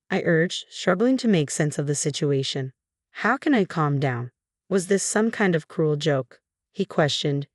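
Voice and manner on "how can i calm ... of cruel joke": ordinary delivery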